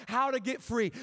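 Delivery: ordinary talk, nothing marked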